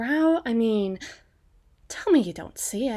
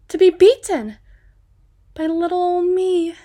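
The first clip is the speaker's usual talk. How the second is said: loud, high mock-innocent boast; gloating taunt; 8 semitones higher, 9 dB louder than usual. Woman reminding gang of their defeat, loudly gloating. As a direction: crow over the beaten gang, pitched up. Taunting the boys she once beat.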